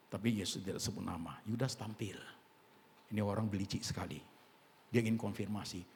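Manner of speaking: ordinary talk, nothing marked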